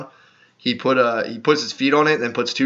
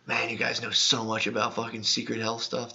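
much softer, to yourself; retold quiet awe; 3 semitones lower, 11 dB quieter than usual. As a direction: marvel under your breath, retelling it. Quoting own awed remark to himself.

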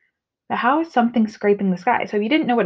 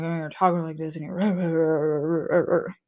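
grumbling; 4 semitones lower, 4 dB quieter, much slower than usual. Shown as a demonstration. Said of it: "grumbling is like".

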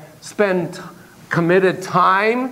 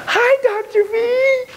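put-on very high, squealing little-kid voice; eager child's greeting; much slower than usual. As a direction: greet the doctor like an excited little client. Child client greets the therapist.

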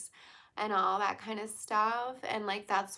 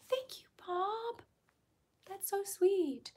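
sweet high thanks; 12 semitones higher, slower than usual. Real, not acted. Thanking a commenter.

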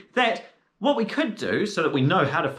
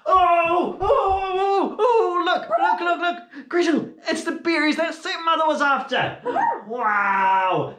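excited cries; 8 semitones higher, slower than usual. Acted. Hansel spots the berries, excited high cries. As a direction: shout with glee at the find.

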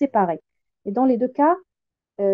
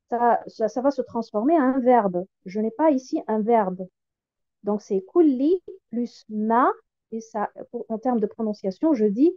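ordinary talk

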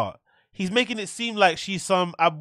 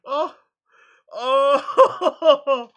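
ordinary talk

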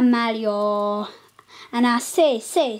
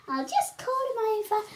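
ordinary talk

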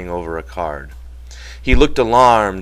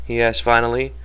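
usual speaking voice

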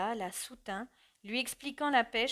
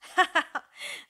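higher, louder forced laugh in bursts; forced jerky laugh; 8 semitones higher, 7 dB louder than usual. A narrator's reading. Son laughs mockingly at his mother, forced and jerky. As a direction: push out a jerky, willed laugh. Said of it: "d'un rire voulu et saccadé".

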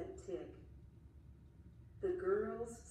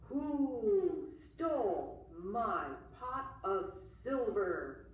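slow, loud, looming monster voice; menacing monster; 4 dB louder, slower than usual. Acted. Monster hunting the boy who stole its silver, slow and loud. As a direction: drag each word out like a beast on the hunt. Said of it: "said the monster".

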